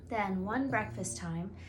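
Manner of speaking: plain reading voice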